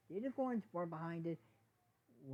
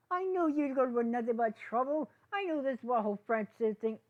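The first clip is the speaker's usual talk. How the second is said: loud, high, furious yelling; angry yell; 8 semitones higher, 9 dB louder than usual. Acted. Sprout yells at Izzy from the crowd in fury. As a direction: hurl the accusation across the crowd.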